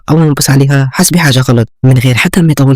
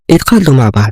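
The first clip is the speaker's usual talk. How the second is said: ordinary delivery, nothing marked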